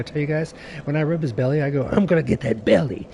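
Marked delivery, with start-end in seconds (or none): 1.9-3.1 teasing cat voice. Retold or imitated. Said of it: "I always do that".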